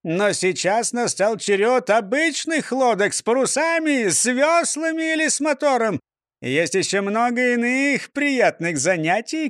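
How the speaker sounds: high jolly mayor voice; beaming, puzzled mayor; cheerful mayor. A narrator's reading. Mayor voice again.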